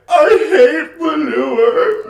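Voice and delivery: high, drawn-out crying shout in a character voice; a slow, howling mock lament; disgusted wail. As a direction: wail it like he's spitting out manure. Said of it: "what I love is when he's like".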